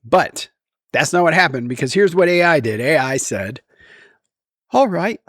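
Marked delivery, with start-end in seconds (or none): 4.7-5.2 high-pitched mock British accent. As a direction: put on an amateurish British voice. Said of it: "I have it doing a British accent".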